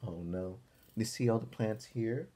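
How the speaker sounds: usual speaking voice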